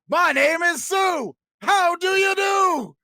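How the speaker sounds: very high, loud, slow shout; challenging shout. Acted. A son confronting the man he thinks is his father, shouting. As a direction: bellow the name as a challenge.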